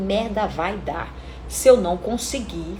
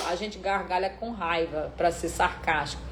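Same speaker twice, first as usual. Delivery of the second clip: soft recap; 5 semitones lower, 10 dB quieter than usual. Shown as a demonstration.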